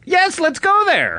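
high, slow put-on old woman; old lady voice. Old lady customer agreeing brightly, high put-on voice. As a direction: chirp back like a sweet old lady.

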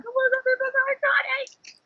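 very high squeaky fangirl gasp; first diary room visit, a very high breathless squeal; overwhelmed squeal. Retold or imitated. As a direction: squeak it out, too thrilled to speak. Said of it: "you're like".

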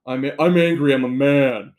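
loud mock angry man, far from own voice. Mocking people who announce their feelings and gender, loud angry man. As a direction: bellow it like a caricature of an angry man. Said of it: "it's something like this".

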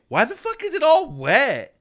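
mock outrage, pitched high and loud; loud retold disbelief; incredulous girlfriend. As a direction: exaggerate a partner's high, loud, suspicious demand. Retelling girlfriend's startled accusation.

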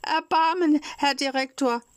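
tearful pleading. A narrator's reading. Puppet pleading with the director, high and tearful. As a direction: beg for mercy, near tears. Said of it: "weinte und flehte".

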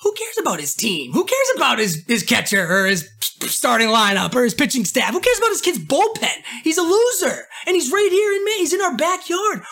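high, heated indignant outburst; indignant rant. Retold or imitated. Ranting to viewers about the kid in Maine, high and indignant. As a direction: pitch climbing as outrage builds. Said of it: "I'm going".

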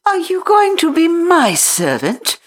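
put-on high, imperious little girl. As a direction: command it like a spoilt little mistress. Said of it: "still in her imperious little Indian way".

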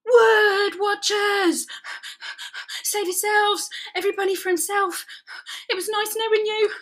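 high, panting cries of alarm; breathless squealing panic; panicked. A narrator's reading. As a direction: flee in comic terror. Rabbit panics at wood watchers.

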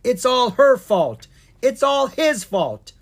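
high, loud, drawn-out accusation; shouting blame. A narrator's reading. Bickering brother and sister dragons, shouting accusations. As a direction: shout the blame onto someone else. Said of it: "pointed a finger at each other and shouted".

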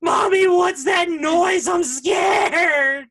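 put-on scared little girl. Little girl on the trail calling to mom, loud and scared. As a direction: cry out like a scared little kid. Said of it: "I hear this little girl".

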